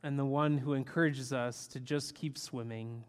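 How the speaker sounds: hushed, reverent opening prayer. Acted. Opening prayer before sermon.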